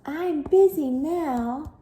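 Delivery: lazy sister shouting; mock shout. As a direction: shout it back, annoyed. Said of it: "shouted Hilda".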